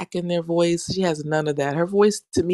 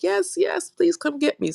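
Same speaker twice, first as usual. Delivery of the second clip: high, pleading mock eagerness; eager; 12 semitones higher than usual. Friend begging for a ride, high and eager. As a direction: beg to be picked up, playfully.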